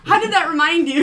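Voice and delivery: high, loud laughing question; incredulous, through laughter; hammed-up puzzled outburst; mock bafflement. Acting out baffled reaction to tangent.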